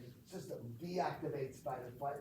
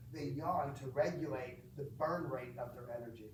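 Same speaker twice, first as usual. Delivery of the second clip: ordinary talk, nothing marked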